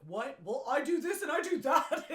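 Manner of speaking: high, loud defensive husband voice. Retold or imitated. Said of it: "So he gets defensive".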